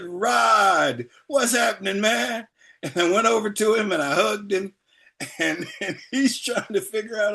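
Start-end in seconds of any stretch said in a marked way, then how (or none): 0.0-2.5 put-on high, hearty hail to an old pal; hearty fake-familiar greeting. Man greeting [name] to impress the young ladies, hearty fake familiarity. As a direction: greet a star like your best buddy.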